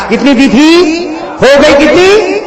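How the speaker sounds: loud, high preaching that builds with each number; full-force preaching. Acted. Preacher counting the prayers down to the crowd, loud and rising. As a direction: call it out to the crowd like a fiery preacher.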